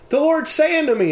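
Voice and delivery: retold daughter calling out to the family; daughter's voice. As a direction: speak as the nine-year-old daughter, urgent. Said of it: "And she said".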